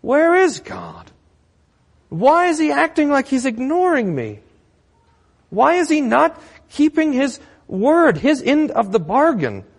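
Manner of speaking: very high, protesting questions; complaining woman. Acted. Canaanite woman questioning why God ignores her, high-pitched protest. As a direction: demand answers, voice pitched right up. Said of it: "what this Canaanite woman would say".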